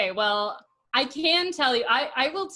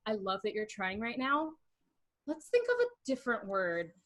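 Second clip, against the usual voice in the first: softer, careful encouragement; gentle feedback; 5 semitones lower, 8 dB quieter than usual. Retold or imitated. Giving her partner constructive feedback, gently. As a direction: let him down easy, softly.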